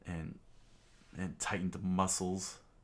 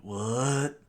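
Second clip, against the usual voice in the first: loud, higher, rising cry of disbelief; shocked; 4 semitones higher, 6 dB louder than usual. Acted. Don Krieg sees the attack coming, shocked. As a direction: burst out in stunned disbelief. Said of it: "Don Krieg was shocked".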